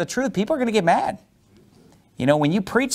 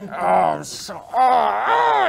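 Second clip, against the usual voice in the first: talking through teeth; 5 semitones higher, much slower than usual. Shown as a demonstration. Preacher acting out teeth-grinding anger, strained through the teeth. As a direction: let the fury squeeze out past closed teeth. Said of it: "you start talking through your teeth like this".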